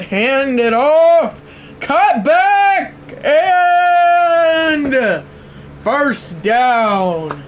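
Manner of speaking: very high, loud announcer yell; excited play-by-play. Acted. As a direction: scream the cutback call. Live play-by-play call.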